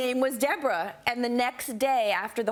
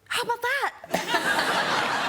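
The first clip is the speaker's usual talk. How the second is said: slow, high, laughing mock wonder; mock amazement; 12 semitones higher, much slower than usual. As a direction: stretch it out in fake awe, laughing. Sarcastic reply to [name].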